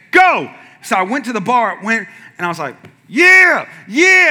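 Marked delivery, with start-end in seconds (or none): 0.1-0.5 sudden high, loud starting call; shouted command. Retold or imitated. Coach starting the workout, shouted command. 3.1-4.3 high, loud lifting yells; psyched-up yell. Retold or imitated. Lifter psyching himself up at the bar, yelling.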